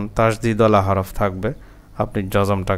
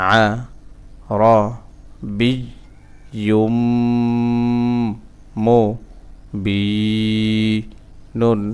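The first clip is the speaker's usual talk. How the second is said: syllable by syllable; much faster than usual. Shown as a demonstration. Teacher breaking a word down for learners, syllable by syllable.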